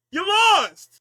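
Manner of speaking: high, loud mock yell of You lost!; imitating a wrestler yelling lost. Quoting Aldis yelling at Drew.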